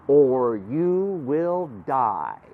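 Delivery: very slow, drawn-out warning; ominous reading. Acted. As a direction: stretch every word of the threat. Reading out ominous magic words.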